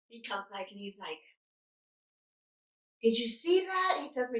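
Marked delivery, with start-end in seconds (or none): 3.0-4.0 high-pitched, incredulous question; imitating indignant nurse. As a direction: play a nurse demanding a witness. Retold agitated nurse complaining.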